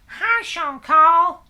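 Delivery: high, loud, drawn-out American greeting; Jessica. Acted. Helper greets the host on camera, high American impression. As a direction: squeal it slowly in a hammed-up American voice. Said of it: "That's how Jessica talks".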